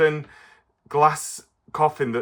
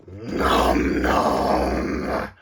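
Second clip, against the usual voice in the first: munching creature voice; 10 semitones lower than usual. Acted. Puppet Stripe rude remark.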